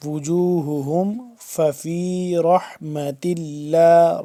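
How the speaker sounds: slow, sustained Quran chant; measured recitation for learners to follow; tajweed recitation. Shown as a demonstration. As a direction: stretch the long vowels evenly. Teacher recites the verse for pupils.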